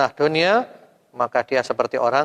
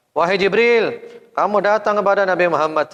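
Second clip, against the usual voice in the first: commanding divine voice; 6 semitones higher than usual. Acted. God commanding Jibril to visit the Prophet, raised and brisk. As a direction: send the angel off with authority. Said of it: "Lalu Allah kirim malaikat Jibril".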